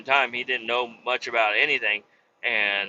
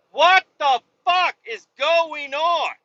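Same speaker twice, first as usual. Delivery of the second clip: high, loud, slow frustrated shout; loud slow exasperated what the fuck; exasperated outburst; 6 dB louder, slower than usual. Retold or imitated. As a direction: stretch it out in exasperation. Truck driver hasn't got dumpsters.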